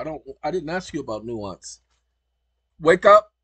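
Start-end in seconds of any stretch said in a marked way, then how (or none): 2.8-3.3 yelling at the phone to wake up; loud shout. Real, not acted. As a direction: shout it up high, rising at the end. Yelling at the assistant.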